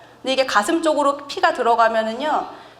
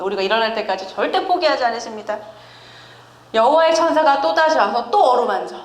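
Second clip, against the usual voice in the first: ordinary delivery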